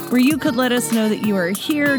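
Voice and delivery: ordinary delivery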